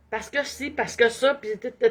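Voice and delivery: ordinary talk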